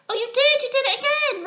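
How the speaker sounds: high, loud surprised cry; surprised exclaim. Real, not acted. Child sneezes during story.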